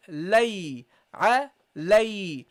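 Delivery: "lay" drawn out slowly with a clear ay; slow careful syllables. Shown as a demonstration. Teacher shows students the right lay, slow. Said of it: "So you say".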